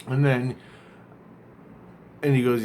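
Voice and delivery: ordinary talk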